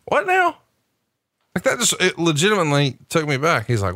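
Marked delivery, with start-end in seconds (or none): 0.1-0.6 high incredulous what now; stunned retelling of his reaction; retold disbelief. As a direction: replay the shock, pitched way up. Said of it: "I'm like".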